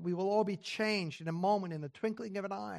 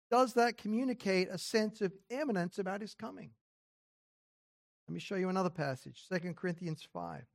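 ordinary talk, nothing marked

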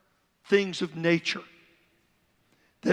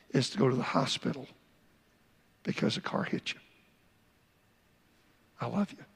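loving father; 2 semitones lower than usual. Shown as a demonstration. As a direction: comfort him gently as a loving dad. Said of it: "a loving way".

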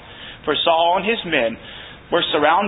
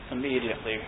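ordinary talk, nothing marked

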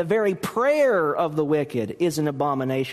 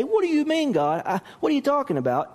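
high, puzzled protest; incredulous priests; 8 semitones higher, faster than usual. Retold or imitated. Pastor voices priests questioning God, high baffled protest.

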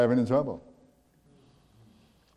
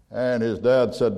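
usual speaking voice

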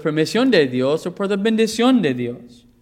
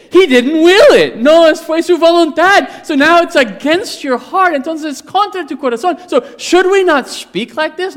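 much higher and louder, near shouting; fervent peak preaching; 12 semitones higher, 10 dB louder than usual. Acted. Preacher warning the congregation, at full shout. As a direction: thunder it from the pulpit.

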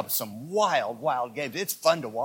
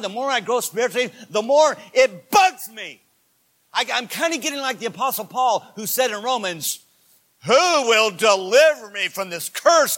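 loud, high sermon shout; full-force preaching; 9 semitones higher, 11 dB louder than usual. Real, not acted. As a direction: cry it out in impassioned confession.

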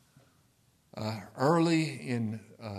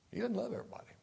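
whisper; 10 semitones higher, 5 dB quieter, much faster than usual. Real, not acted. Preacher drops to aside.

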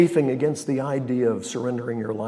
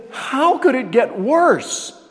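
mock despair; 13 semitones higher, 6 dB louder, slower than usual.

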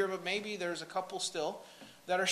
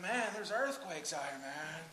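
surfer dude; 4 semitones lower, 8 dB quieter than usual. Retold or imitated. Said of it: "That's how he talks all the time".

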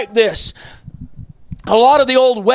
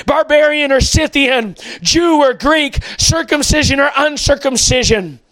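high, fervent preaching cry; impassioned preaching; 3 semitones higher than usual. Real, not acted. Preacher longing for a united church, impassioned high cry. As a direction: lift the voice up in longing.

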